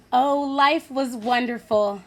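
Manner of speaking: usual speaking voice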